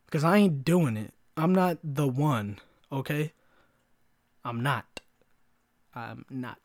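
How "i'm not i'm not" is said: "not" ending on a crisp T; "not" with a hard, released T; emphasized final T. Retold or imitated. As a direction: hit the T at the end of "not". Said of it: "really emphasizes the T".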